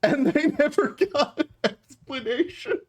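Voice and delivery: high-pitched, laughing as you tell it; real laughing. Real, not acted. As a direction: keep telling it through giggles. Storyteller cracking up at own story.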